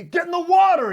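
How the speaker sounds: high, loud yelled command; furious order. Acted. Connor yelling at his henchman to dive in. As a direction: scream the order. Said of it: "repeated order".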